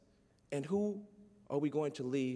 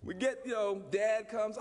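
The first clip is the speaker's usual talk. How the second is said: normal talking voice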